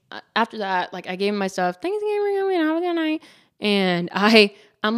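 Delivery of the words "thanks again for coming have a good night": high-pitched customer-service singsong; mock-sweet high farewell; put-on cheery goodbye. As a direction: send it way up high like a perky waitress. Re-enacting her goodbye to the customer.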